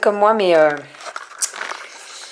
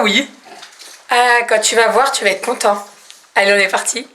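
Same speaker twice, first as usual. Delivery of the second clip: normal talking voice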